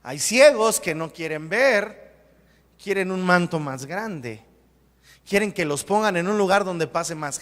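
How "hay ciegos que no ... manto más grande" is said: normal talking voice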